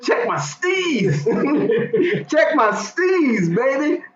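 high, slow, laughing swagger; playful high hype voice. Pretend. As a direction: stretch it out, showing off. Playful boast about his style.

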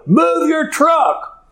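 high, drawn-out mock yell; retold yelling. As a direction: yell it slowly like the irate driver being imitated. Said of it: "She starts yelling at him".

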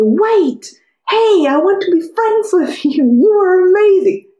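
mock-blurt it out, keen to make friends; eager blurt. Imagined calling out to strangers.

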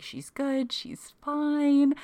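put-on husband reassuring his wife the baby is fine. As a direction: tell her the baby is fine. Husband reassuring after birth.

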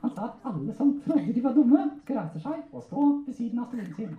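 high, eager ventriloquist puppet voice; puppet storyteller. Acted. Puppet telling handler a school story, high comic voice.

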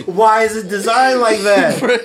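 mock outrage. Mock outrage at keyboard layout.

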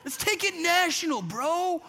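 high, laid-back bro voice; hyped-up dude pitching a road trip; surfer dude. Retold or imitated. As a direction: play it like a chill surfer bro. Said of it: "they would have said it like that".

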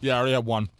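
talking through a mouthful of chips; crunching chips. Real, not acted. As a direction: speak while still crunching a chip. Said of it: "let me finish this chip".

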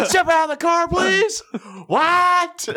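high, strained mock yell; comic high-pitched shout; shouting over helicopter. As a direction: call out over deafening rotors. Shouted exchange under helicopter.

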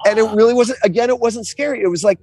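ordinary delivery

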